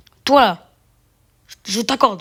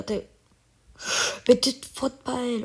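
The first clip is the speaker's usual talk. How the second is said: ordinary talk, nothing marked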